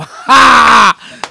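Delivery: loud, high burst of forced laughter; hard mocking laugh. Guest demonstrates laughing at a ten-year-old, loud mock guffaw. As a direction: blast out one big laugh. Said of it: "me reiría bien duro primero".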